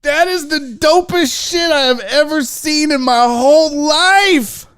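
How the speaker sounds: loud, high, thrilled declaration; excited raving. Real, not acted. Unboxer praising a gift from friends, excited raving. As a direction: proclaim it loudly, overjoyed.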